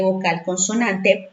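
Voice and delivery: ordinary delivery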